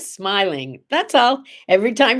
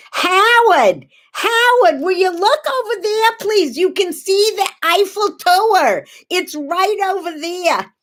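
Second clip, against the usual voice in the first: [name] yelling; 11 semitones higher than usual. Acted. From a hotel window, calling husband below in the street, brash high yell. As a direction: shriek for Howard like a brash New York tourist. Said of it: "out the window I yelled".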